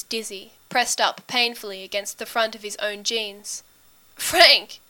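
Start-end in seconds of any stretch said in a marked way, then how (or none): 4.1-4.8 high, loud desperate cry. A narrator's reading. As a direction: call out the name in desperation. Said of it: "he says desperately".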